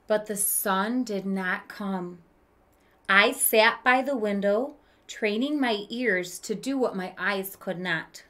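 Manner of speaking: gentle read-aloud storytelling voice; story narration. A narrator's reading. Teacher reading a picture book to kids, storytelling voice. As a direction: read it like a children's book to the class.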